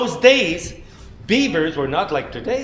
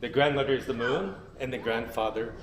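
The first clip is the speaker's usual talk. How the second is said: lower, softer, fading reverent words; solemn gentle telling; 5 semitones lower, 9 dB quieter than usual. Acted. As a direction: speak it like sacred teaching. Solemn teaching closing.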